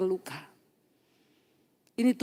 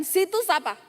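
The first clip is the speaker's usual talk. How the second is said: higher, abrupt challenge; curt, rude asking; 7 semitones higher than usual. Retold or imitated. Bitter woman challenging the preacher earlier, curt and rude. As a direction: imitate someone brusquely demanding who you are. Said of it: "Tadi kan".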